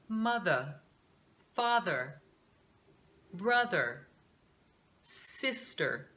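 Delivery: "mother, father, brother, sister" each ending in a light r-coloured er; unstressed American er. Shown as a demonstration. Teacher giving example words, each ending in an unstressed American er. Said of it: "esse fonema aqui? Em palavras como".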